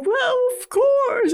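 very high, drawn-out falsetto; imitated delighted wife. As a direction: coo it slowly in falsetto. Acting delighted wife's answer.

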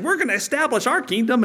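high, puffed-up boast; mocking the kings. Preacher mocking the earthly kings' boast. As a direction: mimic a puny ruler bragging. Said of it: "they're saying".

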